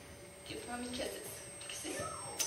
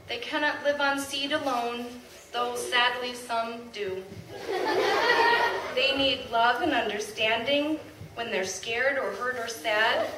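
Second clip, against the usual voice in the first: proud, louder poem reading with laughs; poem recital; 2 semitones higher, 6 dB louder than usual. Acted. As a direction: recite it to the crowd with pride. Reads a poem aloud.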